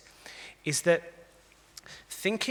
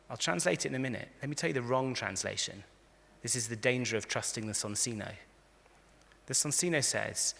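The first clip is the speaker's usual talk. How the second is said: normal talking voice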